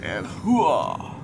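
bellowed army hooah; loud gung-ho soldier. Pretend. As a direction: shout it like a pumped-up soldier. Cheering on in-game action.

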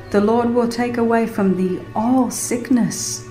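ordinary delivery, nothing marked

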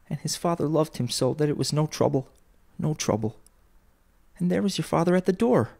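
high, gentle mother's voice; fond and hushed, pitch well lifted; tender mother. A narrator's reading. Mother recalls the father's love, tenderly.

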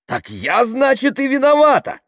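loud outburst leaping high in pitch; indignant. A narrator's reading. Tamara blamed by the crowd, indignant. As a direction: protest in loud outrage. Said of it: "возмутилась Тамара".

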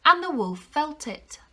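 ordinary delivery

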